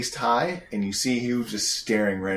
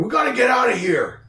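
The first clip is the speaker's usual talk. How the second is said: high, loud panicked shout; acted alarm, pitched way up; panicked gang leader; 12 semitones higher, 5 dB louder than usual. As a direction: yell it like a fleeing henchman. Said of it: "the leader seems to be going".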